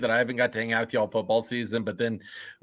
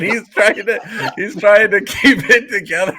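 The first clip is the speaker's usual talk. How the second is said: higher, louder, laughing through the words; holding back laughter; 8 semitones higher, 6 dB louder than usual. Real, not acted. Host teasing co-host about keeping it together, laughing. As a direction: struggle to talk through rising laughter.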